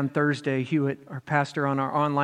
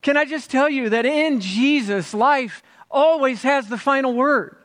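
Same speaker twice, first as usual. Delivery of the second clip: full-force preaching; 11 semitones higher, 7 dB louder than usual. Acted. Pastor proclaiming to the congregation, full-force preaching. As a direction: preach it to the rafters.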